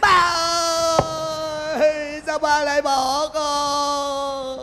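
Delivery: high, slow drawn-out mourning wail; hammed-up funeral lament; fake wailing. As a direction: wail for the dead father like a paid mourner. Hired mourner wails again.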